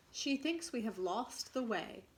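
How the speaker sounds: usual speaking voice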